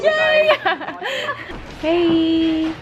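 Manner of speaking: high laughing yay; excited cheer. Real, not acted. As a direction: cheer with a giggle. Excited about gift card.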